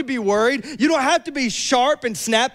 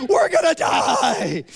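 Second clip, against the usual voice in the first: higher, slow hammed-up yell; mock yell; 9 semitones higher, slower than usual. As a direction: yell like you're about to drown. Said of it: "by yelling at him".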